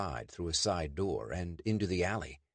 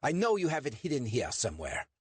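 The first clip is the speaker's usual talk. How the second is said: put-on German-accented demand, pitched up; 7 semitones higher than usual. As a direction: press for the artifact like a German officer. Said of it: "the German demanded".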